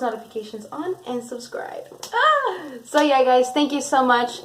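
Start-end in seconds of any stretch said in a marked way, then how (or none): 2.1-2.7 short, high "ah" squeal; excited squeal. Real, not acted. Vlogger signing off to viewers, excited squeal. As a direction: let out a little high-pitched cheer. Sign-off cheer.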